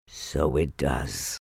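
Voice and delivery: low, soft, slow bear voice; Pooh. A narrator's reading. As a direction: marvel slowly, low and soft. Pooh's take against Piglet's.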